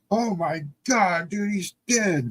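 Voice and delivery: high, alarmed cry that he's dead; panicked friends. Retold or imitated. Friends looking down after fall.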